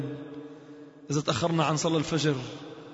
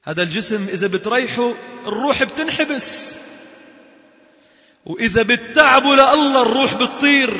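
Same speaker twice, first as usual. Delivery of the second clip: emphatic preaching; 5 semitones higher, 8 dB louder than usual. Real, not acted.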